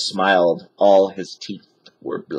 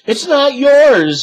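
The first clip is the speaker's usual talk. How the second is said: very high, loud, slow protest; Annie shouting; 7 dB louder, slower than usual. A narrator's reading. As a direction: yell at the pirates. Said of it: "shouted Annie".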